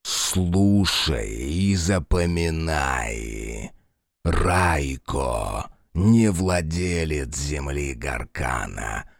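slow, deep, thundering dragon voice; a god-dragon pronouncing judgement; vast deep cosmic voice. Acted. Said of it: "громовой голос не осадил его".

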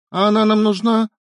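calling back. A narrator's reading. Wife answering husband from the kitchen, calling back. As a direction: answer loudly from another room.

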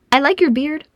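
put-on high, piping child's compliment; loud little girl. A narrator's reading. Little girl to the bearded dwarf, piping loudly. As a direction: pipe it up brightly as a small girl. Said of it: "said the girl loudly".

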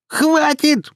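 very high-pitched squealed cry; sudden squealing outburst; shriek. A narrator's reading. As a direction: shriek to stop a quarrel. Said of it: "взвизгнула мать".

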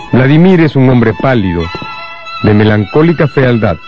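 normal talking voice

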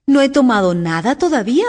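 a touch of huffiness; offended. A narrator's reading. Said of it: "replicó Alicia con tono ofendido".

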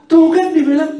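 high, loud taunting sing-song; playful mock taunt, high and loud; sing-song told-you-so. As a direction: chant it like a mocking nag. Parent scolding child.

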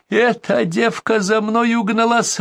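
slow, very high-pitched yelling; narrated yell. A narrator's reading. Bandit rants about his hostage, yelling high. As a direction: stretch it out in a high, ranting cry. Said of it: "орал бандит".

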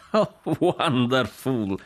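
high, drawn-out exclamation of delight; delighted cry. Acted. Jack delighted by the soap story, crying out. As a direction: cry it out slowly, relishing it. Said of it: "gridò Jack".

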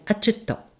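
usual speaking voice

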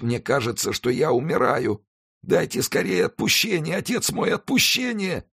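very high, desperate pleading; moaning in agony. A narrator's reading. Dying man begs monk for absolution, moaning and high. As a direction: beg as if life is slipping away. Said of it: "стонал палач".

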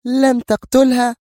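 high, loud, drawn-out woman's outcry; angry cry. Acted. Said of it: "هتفت جيهان غاضبة". (صيحة غاضبة بصوت عالٍ)

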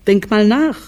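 high, quick reproachful voice; reproachful wife. A narrator's reading. Wife reproaches her husband over lunch excuses, high and quick. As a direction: throw his own words back at him.

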